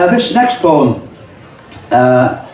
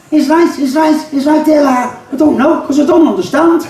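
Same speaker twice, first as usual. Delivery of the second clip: much higher, bewildered mock voice; baffled listener; 9 semitones higher than usual. Poet mimicking a confused old Scouser, much higher. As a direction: play it puzzled and high. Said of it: "like a foreign language".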